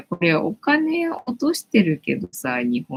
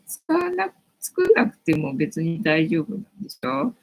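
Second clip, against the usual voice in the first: normal talking voice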